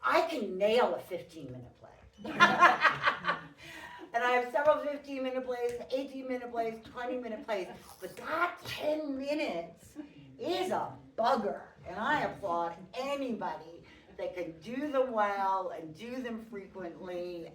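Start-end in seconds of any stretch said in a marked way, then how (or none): 0.0-2.3 laughing through the line; louder, breaking into laughter. Real, not acted. Self-deprecating joke about playwriting. 2.4-4.1 loud high laugh; big delighted laugh; burst of laughter. Real, not acted. Laughs at own joke. 4.1-15.1 excited. Real, not acted. Excited rant after laughing.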